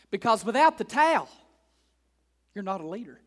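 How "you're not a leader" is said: ordinary talk, nothing marked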